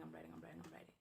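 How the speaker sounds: repeated words sink from soft into a whisper; fading to a whisper. Acted. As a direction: let the voice drain away to a breathless whisper as you keep writing. Repeats writing, then fades to whisper.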